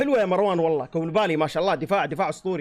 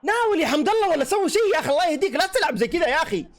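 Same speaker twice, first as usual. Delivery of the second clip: much higher, exasperated shout at the screen; frustrated yelling; 9 semitones higher than usual. Real, not acted. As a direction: yell at him to pass the ball. Fan yelling at player on screen.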